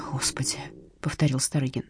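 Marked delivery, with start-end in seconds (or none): 0.1-0.6 hushed shocked. Acted. Repeats after hearing details.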